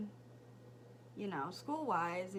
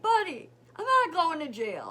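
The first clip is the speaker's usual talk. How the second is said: emphatic reassurance; 6 semitones higher, 12 dB louder than usual. Retold or imitated. Reassuring son, loud.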